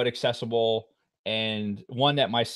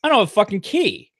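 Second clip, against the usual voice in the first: mock alarmed outburst, higher and louder; oh no moment, raised and loud; retold dismay; 7 semitones higher, 5 dB louder than usual. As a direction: blurt it out in sudden dismay. Realizing he's locked out.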